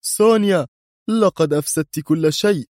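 high, angry accusing voice. Acted. A machine-altered voice scolds Sonia in anger, high. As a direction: blame her sharply, name first. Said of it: "يقول في غضب".

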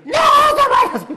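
very high slow shriek; hammed-up shriek. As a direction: shriek to stop him leaving. Wife shrieking in panic.

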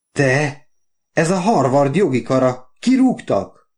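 high, exclaimed outburst; excited guess. A narrator's reading. Wife guesses at the Harvard letter, excited. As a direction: blurt it out, pitch leaping at the end.

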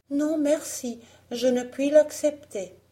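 high-pitched, polite refusal; declining young heroine. Acted. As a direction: turn down the gift in a lifted voice. Blanche décline la pomme.

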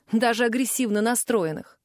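ordinary delivery, nothing marked

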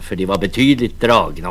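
usual speaking voice

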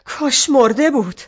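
loud bitter outburst; shouting. Acted. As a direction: shout it out in despair. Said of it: "داد زد".

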